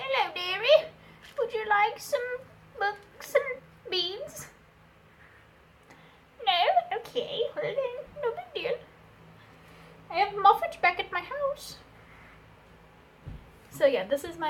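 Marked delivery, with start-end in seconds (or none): 0.0-4.5 high sweet granny voice; elderly singsong. Acted. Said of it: "to be like". 6.4-11.8 high quavering granny voice; sweet old lady, a bit let down; elderly singsong. Acted. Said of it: "to be like".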